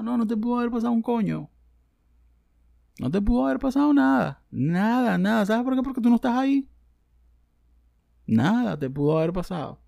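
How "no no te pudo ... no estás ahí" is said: higher, louder exasperated retort; exasperated rant. Real, not acted. Scolding the self-centred poster, exasperated. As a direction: snap back at them.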